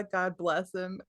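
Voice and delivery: normal talking voice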